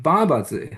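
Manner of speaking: "爸爸字" high on the first syllable, then falling; tone pattern. Shown as a demonstration. Lecturer gives the word meaning handle, tone pattern. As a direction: start "爸爸字" high and drop. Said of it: "different tone patterns for different".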